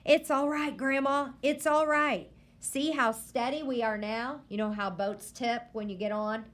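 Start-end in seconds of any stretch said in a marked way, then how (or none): 0.0-4.4 put-on higher boy's voice soothing grandma; calm her down; reassuring boy. A narrator's reading. Boy calming scared grandma.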